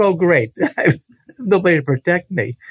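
wry, high-pitched dismay with laughter; rueful laughter. Retold or imitated. Man recalling his lost protection as grandmother hands him over, laughing ruefully. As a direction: laugh through the boy's dismay. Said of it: "I thought".

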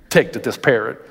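normal talking voice

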